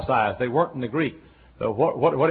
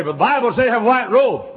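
mock objector; 10 semitones higher, 8 dB louder than usual. Mocking a churchgoer's objection.

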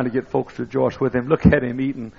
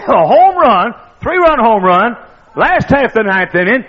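high, loud breathless recap; preacher reliving the home run; excited shout; 11 semitones higher, 11 dB louder than usual. Retold or imitated. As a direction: keep riding the thrill of the call. Still reliving the radio call.

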